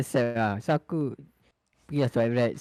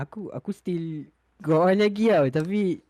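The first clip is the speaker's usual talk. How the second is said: usual speaking voice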